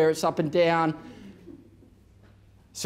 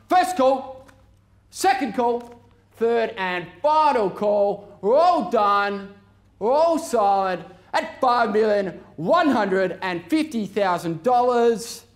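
slow, drawn-out final calls, rising; auctioneer calling; 3 semitones higher, slower than usual. Real, not acted. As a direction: stretch out going, going. Final calls before the hammer.

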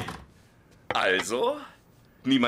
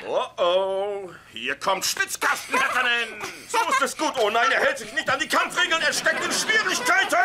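hyped ringside announcer, much higher; wrestling commentator; 13 semitones higher, 6 dB louder than usual. Pretend. Father play-wrestling with his son, hyped announcer voice. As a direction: call the match like a TV wrestling host.

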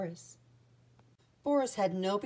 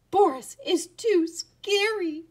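high, slow, loud squeak; squeaking mice; 11 semitones higher, 5 dB louder, slower than usual. A narrator's reading. All the mice complaining about Boris together, squeaking. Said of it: "they all squeaked together".

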